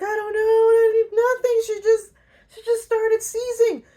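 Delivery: high frantic mock panic; panicked denial. Woman accused of poisoning, panicking high and frantic. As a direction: squeal out the denial in alarm.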